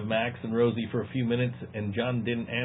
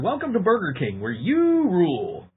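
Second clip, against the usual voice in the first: higher, slow mock fast-food greeting; drive-thru speaker voice; 6 semitones higher than usual. Friend imitates the Burger King speaker he heard nightly. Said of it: "every single night I heard".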